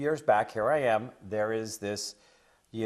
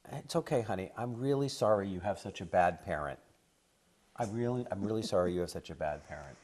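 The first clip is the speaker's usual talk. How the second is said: gentle comforting; 4 semitones lower, 5 dB quieter than usual. Retold or imitated. Comforting the child on bended knee.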